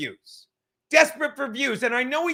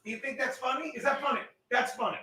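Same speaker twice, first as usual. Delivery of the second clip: soft scolding; 3 semitones higher, 9 dB quieter, faster than usual. Real, not acted. Scolding the cat softly.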